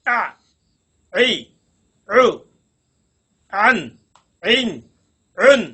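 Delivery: each sound pressed from the middle of the throat, spaced out; throaty ain vowels. Shown as a demonstration. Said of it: "কিভাবে উচ্চারিত হবে". (গলার মধ্যখান থেকে আইনের উচ্চারণ)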